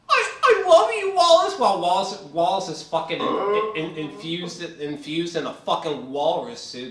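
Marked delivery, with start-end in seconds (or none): 0.1-1.6 loud, squeaky-high lovey imitation; mock her devotion in a high voice; mock girlfriend voice. Reviewer mocking her while Wallace is in a walrus suit, high girlfriend voice. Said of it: "This bitch talking about some".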